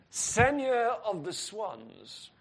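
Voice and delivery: slow, drawn-out French accent. Shown as a demonstration. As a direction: say it grandly in a French accent, drawing it out. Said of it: "say that in a French accent".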